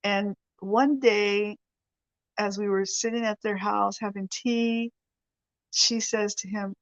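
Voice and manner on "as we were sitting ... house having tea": ordinary talk, nothing marked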